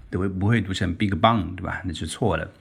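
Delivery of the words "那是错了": ordinary talk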